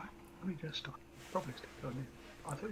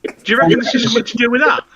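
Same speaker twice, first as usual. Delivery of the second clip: pontificating; 5 semitones higher than usual. Acted. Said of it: "Arthur is pontificating".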